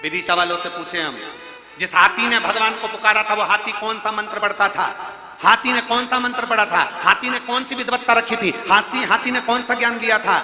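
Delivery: loud, driving sermon cadence; fervent preaching. Acted. Preacher rouses the listeners about the elephant, loud and fervent. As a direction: hammer the point home to the congregation.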